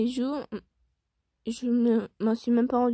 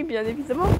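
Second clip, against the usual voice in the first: usual speaking voice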